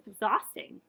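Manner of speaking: usual speaking voice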